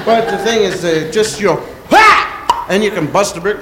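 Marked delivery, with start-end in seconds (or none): 1.9-2.6 sharp, much higher and louder ha; karate shout. Shown as a demonstration. Comic demoing the brick-breaking shout, loud sharp karate yell. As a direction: bark a karate ha. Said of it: "give off a loud shout".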